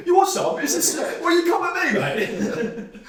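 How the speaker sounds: very high, loud hammed-up British tough guy, laughing through it; mock tough British bloke. Mocking Stephen Fry as a rowdy British bloke, high and laughing. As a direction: squeal it like a cartoon Brit picking a fight.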